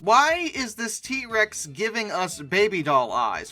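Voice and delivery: normal talking voice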